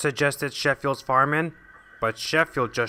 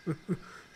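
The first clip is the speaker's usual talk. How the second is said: sinister chuckle; 2 semitones higher, 13 dB quieter than usual. Acted. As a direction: chuckle like a schemer. Villain laughs.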